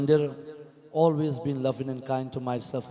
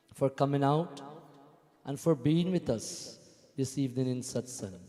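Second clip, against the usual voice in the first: usual speaking voice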